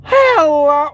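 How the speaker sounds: high, loud, drawn-out hello; Sun. Acted. Voice actor showing viewers his Sun impression, high and loud. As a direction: greet brightly in a high, booming cartoon voice. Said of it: "I can do Sun and Moon".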